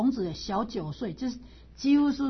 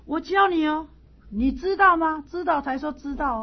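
higher, louder, coaxing question; Confucius asking; 6 semitones higher, 5 dB louder than usual. Retold or imitated. Confucius asking Zilu, higher and louder. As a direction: lean in and press him gently. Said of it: "所以孔子就跟他讲说".

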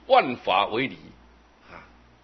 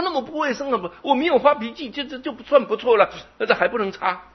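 much higher and louder, exasperated; indignant protest; 14 semitones higher, 7 dB louder, faster than usual. Retold or imitated. Hot-tempered young man arguing with the master, shrill and indignant. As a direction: argue back like he's been wronged.

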